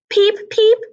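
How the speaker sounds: tiny high peeping chicks; squeaky little peep peep; baby chick peeps. A narrator's reading. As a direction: peep like newborn ducklings. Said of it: "said the little things".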